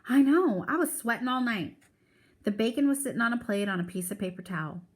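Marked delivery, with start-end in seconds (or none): 0.1-1.8 higher, louder mother voice; Mama complaining. Acted. Mama telling Grandma about the hot night, higher complaining voice. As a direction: let her fret about the heat.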